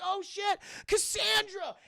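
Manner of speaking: high, alarmed acted cry; hammed-up shock at finding a friend. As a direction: call out the name in mock horror. Meg sees melted friend.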